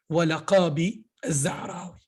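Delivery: Walaqabi with a deep throat q, slowly. Shown as a demonstration. Teacher modelling the right name for students, deep q. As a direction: Walaqabi Zahrawi, stressing the throat q. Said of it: "c'est pas walakabi".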